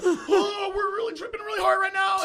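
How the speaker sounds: high, loud mock wail; freaking out. Imitating his tripping friends, freaking out in a wail. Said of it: "and they're freaking out".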